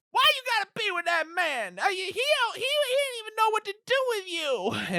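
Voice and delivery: put-on very high, whiny kid-brother voice; hammed-up squealing tantrum; huffy twelve-year-old. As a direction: protest like an indignant little boy.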